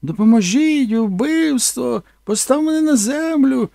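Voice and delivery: high, loud cry of murder; mock screaming actress. Actress hitting the creature and screaming, high loud mock scream. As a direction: shriek it as if attacked. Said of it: "почала бити мене і кричати".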